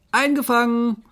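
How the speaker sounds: high, loud call; triumphant shout. Acted. Keepers have caught the animals, shouting. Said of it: "schrien die Tierpfleger".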